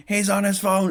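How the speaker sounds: high, loud tattling shout; mock yell. Brother telling on him to the crowd, imitated high yell. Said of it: "and then yells".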